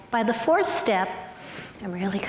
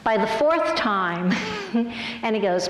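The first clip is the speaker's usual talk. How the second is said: ordinary delivery, nothing marked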